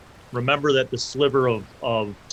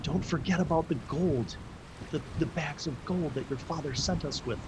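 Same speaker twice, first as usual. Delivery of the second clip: high, hushed secretive voice; mother's whisper; 4 semitones higher, 8 dB quieter than usual. Acted. Mother whispering in your ear about the gold, hushed. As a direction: speak softly and high, close to the ear. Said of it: "whispers in your ear".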